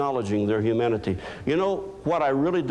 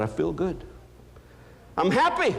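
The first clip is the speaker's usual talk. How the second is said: higher woman's voice, cheerful; the female doctor tells him she's happy, higher and cheerful; doctor's voice; 7 semitones higher than usual. Retold or imitated. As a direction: lift into her bright, pleased tone. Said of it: "And she said".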